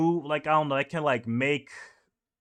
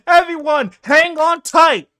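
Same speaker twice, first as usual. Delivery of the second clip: high, loud and slow warning shout; yelling; 12 dB louder than usual. Retold or imitated. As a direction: shout so the whole ship hears.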